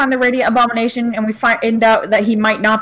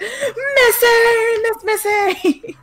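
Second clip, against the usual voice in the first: high, drawn-out mock excited cry; Anarchy; 11 semitones higher, much slower than usual. Player imitating another's reaction to the mistress, high excited call. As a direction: mimic an overexcited squeal, stretching the name. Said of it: "Anarchy's just like".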